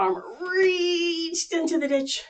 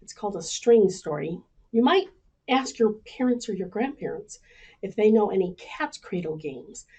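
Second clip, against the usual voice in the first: usual speaking voice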